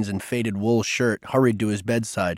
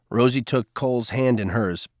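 plain reading voice

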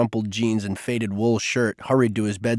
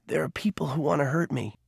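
hushed, fearful confession; a frightened boy's whisper; 4 semitones higher, 5 dB quieter than usual. Acted. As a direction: whisper it like a scared secret. Frightened Cole confides.

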